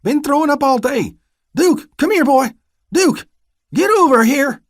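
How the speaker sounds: very high, loud calling out; frantic calling. Acted. Vernon calling his dog in front of the deputy, frantic. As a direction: call the dog in a panic.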